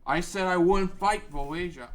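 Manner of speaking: angry retort. Acted. Darunia defends his plan, rising anger.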